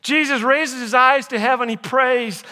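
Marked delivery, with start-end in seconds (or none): none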